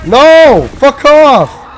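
sudden loud shout of alarm; startled yell. Real, not acted. Gamer yelling at a sudden enemy. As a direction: yell out in a real fright.

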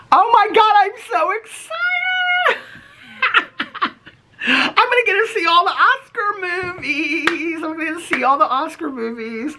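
high-pitched thrilled shriek; excited squeal. Real, not acted. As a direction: squeal with real delight. Thrilled she can watch tonight.

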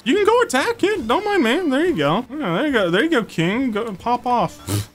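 high cutesy sing-song cheer; cheering pet voice. Real, not acted. Cheering her pal on as it attacks, high cutesy voice. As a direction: egg the creature on like a proud pet owner.

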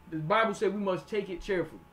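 ordinary delivery

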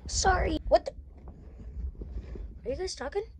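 normal talking voice

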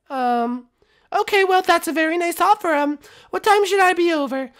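high, polite falsetto princess. Acted. Princess answering the residence phone, high and polite.

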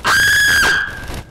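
very high-pitched shriek of alarm; startled scream. Real, not acted. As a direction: scream out in sudden fright. Scared by enemy in game.

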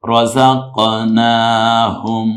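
রাযাকনাহুম slow, with long naa and h released from the throat; stretch, throaty h. Shown as a demonstration. Teacher shows one-alif pull, stretch and throaty h. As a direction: draw out না in রাযাকনাহুম, then let the হ go from the throat. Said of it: "এক আলিফ টান, এই হাঁটা গলায় ছেড়ে".

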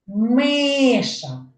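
Миша with Ми held and stressed; stress on first syllable. Shown as a demonstration. Teacher to children, stressing the first syllable of Миша. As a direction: lean on the Ми in Миша. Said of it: "Ви чуєте, як я голосом виділяю".

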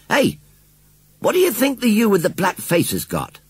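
jolly farmer announcing news. A narrator's reading. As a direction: call it out with a cheerful grin.

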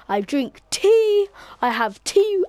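slow, high, plummy mock-posh British accent. Streamer hamming up a posh British tea drinker, posh British accent. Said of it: "but I am British and posh".

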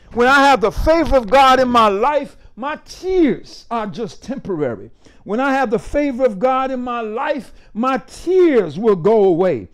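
loud refrain opening, then rolling on; forceful preaching. Real, not acted. Pastor declaring God's favor over tears, forceful preaching. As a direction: drive each repeated line home like a preacher.

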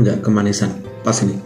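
ordinary delivery

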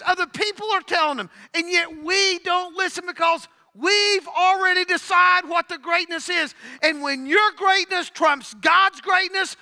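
loud, high, building sermon shouts; preaching at full force. Real, not acted. Pastor rousing the congregation against self-made greatness, full preaching shout.

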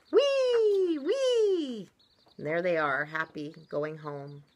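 lively, loud storybook reading with big pitch swings; story read-aloud. A narrator's reading. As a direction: read it big and animated for small children. Reads a picture book to children.

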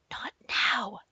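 soft unvoiced whisper. Mom whispers to her son to drop the dog topic, whispered. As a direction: hush the child quickly. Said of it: "Mom whispered to me".

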